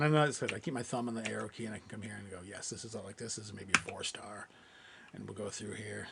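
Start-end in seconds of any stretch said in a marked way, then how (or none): 2.5-4.5 half-whispered, thinking aloud to himself; murmured inner thought. Retold or imitated. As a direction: murmur it like you're deciding privately. Voicing his own culling thoughts.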